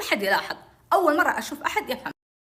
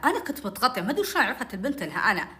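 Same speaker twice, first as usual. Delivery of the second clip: usual speaking voice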